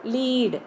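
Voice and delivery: "lead" with a long drawn ee, like leed. Shown as a demonstration. Teacher pronounces the word for students, long-ee "lead". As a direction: say "lead" to rhyme with need. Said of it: "I pronounce the word lead as".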